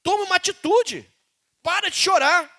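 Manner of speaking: high, loud preaching command; a firm preaching rebuke to the church; stern rebuke. Real, not acted. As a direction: scold them to stop whining. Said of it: "preacher rebuking the church".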